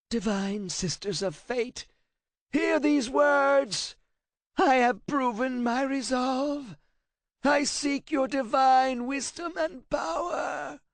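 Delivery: high, slow, loud ritual chant; desperate incantation. A narrator's reading. Translator forced to read the runes aloud, high slow chanting cry. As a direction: intone it as a terrified prayer.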